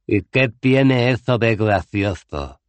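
pregunta amenazante, lenta y más fuerte; Cohen, ofendido, amenaza despacio. A narrator's reading. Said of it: "con una voz llena de nudillos". (amenaza lenta, más aguda y fuerte)

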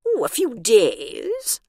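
slow, amused question with a chuckle; a lightly voiced laughing warden. A narrator's reading. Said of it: "laughed the warden".

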